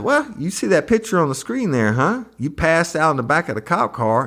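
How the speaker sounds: high mocking prosecutor voice, pitched well above his own; imitating a smug lawyer jabbing a witness. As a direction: sneer it up high like a cocky attorney. Prosecutor grilling witness, retold.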